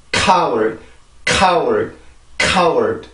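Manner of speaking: 카월 with a puffed, scraping k; stressed 카월, lightly scraped at the back; scraped k demo. Shown as a demonstration. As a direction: hit the k of 카월 and let it fall. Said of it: "살짝 긁히죠".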